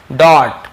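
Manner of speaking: "डॉट" loud with an open aw; careful, emphatic aw in "डॉट"; right pronunciation. Shown as a demonstration. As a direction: hit "डॉट" hard, rounded aw vowel. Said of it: "उच्चारण पे ध्यान दीजिए".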